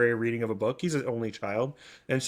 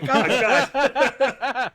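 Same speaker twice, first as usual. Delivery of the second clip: high, louder, laughing oh God; laughing dismay; 11 semitones higher, 5 dB louder than usual. Retold or imitated. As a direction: caught off guard and laughing at it. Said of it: "And I'm like".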